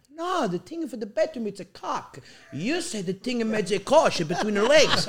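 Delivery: imitated Italian dad. Dad correcting son's word, imitated Italian-accented protest. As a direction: argue back in dad's Italian accent. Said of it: "I said".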